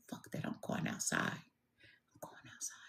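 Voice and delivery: imitating a kid whispering back; hushed, stubborn sibling comeback; defiant whisper. Whispered reply to sister in story.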